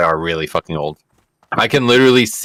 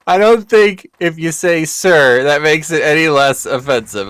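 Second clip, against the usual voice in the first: laughing through it; 8 semitones higher, 4 dB louder than usual. Retold or imitated. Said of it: "I was like".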